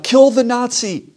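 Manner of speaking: high, loud imitation of a crowd shout; retold yell. Somebody in the crowd spots this guy, retold yell. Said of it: "he yells out".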